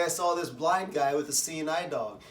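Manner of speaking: ordinary talk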